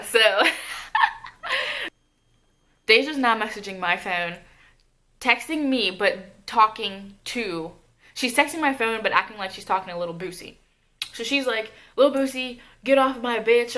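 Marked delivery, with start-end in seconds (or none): 0.2-1.9 very high squealing laugh; real laugh. Real, not acted. Storyteller amused by the siblings' frantic messages, high laugh. 12.0-13.8 louder, higher retold warning; mock scolding. Friend texting the ex to back off, imitated scolding.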